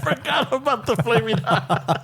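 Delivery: laughing through it; giggling as you say it. Real, not acted. Laughing while speaking.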